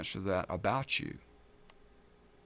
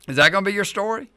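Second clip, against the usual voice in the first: incredulous challenge; 9 semitones higher, 7 dB louder, much faster than usual. Acted. Preacher mocking shallow answer.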